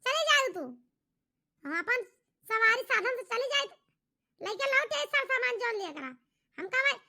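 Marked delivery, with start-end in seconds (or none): none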